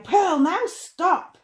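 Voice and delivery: high, loud, slow cry of stop; cried out. Acted. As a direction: call out sharply to halt her. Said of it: "till Mum cried".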